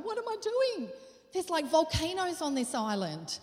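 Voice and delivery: high, frantic panic voice; panicked. Retold or imitated. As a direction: voice climbing in alarm. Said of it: "I was terrified".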